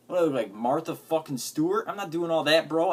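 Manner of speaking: exasperated rant; indignant outburst. Real, not acted. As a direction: scoff at the idea, raising your voice. Ranting about bug-trapping advice.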